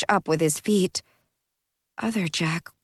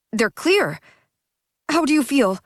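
urgent; 6 semitones higher than usual. Acted. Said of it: "he asked urgently".

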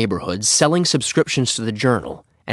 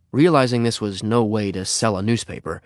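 ordinary delivery, nothing marked

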